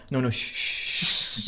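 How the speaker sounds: repeated long "shh" shushing; dad hushing. Retold or imitated. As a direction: imitate a father hushing his son. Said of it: "his dad sort of went".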